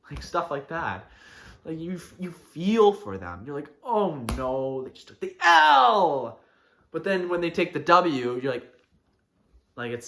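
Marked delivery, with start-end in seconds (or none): 3.8-6.4 building to a high, loud drawn-out cry; mock dismay. Onlooker watching a friend take the L, mock dismay. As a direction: lament a friend's defeat, hammed up. Acts out reaction to friend failing.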